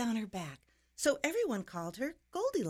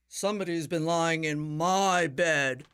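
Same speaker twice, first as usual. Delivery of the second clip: low, loud great bear voice; big papa bear's deep bellow; 4 semitones lower, 7 dB louder than usual. A narrator's reading. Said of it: "bellowed the great huge papa bear".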